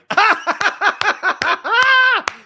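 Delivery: high, loud burst of laughter. Real, not acted. Laughing at offside.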